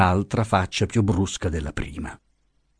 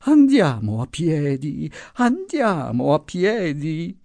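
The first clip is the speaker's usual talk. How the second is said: put-on feeble old voice; 7 semitones higher, slower than usual. A narrator's reading. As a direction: plead faintly like a veiled ventriloquist voice. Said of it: "una voce velata da ventriloquo, gemeva".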